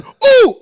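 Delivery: loud, high gorilla-like whoop; hammed-up enraged "ooh" yell; mock yelled hoot. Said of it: "He starts yelling".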